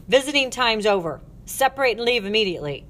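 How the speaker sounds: loud, brisk matron's command; stern order. Acted. Matron ending a prison visit, stern order.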